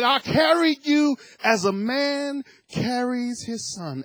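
preacher voicing God to the church, shouted and slow; preaching shout. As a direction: drive each word home as God speaking.

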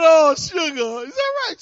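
hammed-up fatherly pity; mock-sympathetic dad. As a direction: coo at your daughter half laughing. Dad teasing daughter tenderly.

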